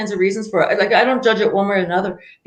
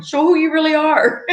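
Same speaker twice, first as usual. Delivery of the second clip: laughing through it, much higher; 10 semitones higher than usual. Real, not acted. Host laughing while urging listeners to be themselves. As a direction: push the words out through a laugh.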